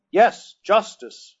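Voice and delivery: slow, high, loud shout; crowd shout. Acted. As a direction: roar it back as a crowd. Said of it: "echoed a dozen voices".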